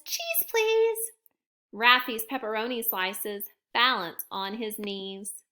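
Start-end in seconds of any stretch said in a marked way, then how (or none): 0.0-1.1 high, bright little cheese please; high child's request. Acted. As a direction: ask sweetly in a high child voice. Child asks for pizza.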